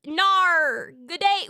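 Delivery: hammed-up Australian twang; Australian accent attempt. Shown as a demonstration. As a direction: exaggerate the Australian vowels. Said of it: "How to speak Australian accent".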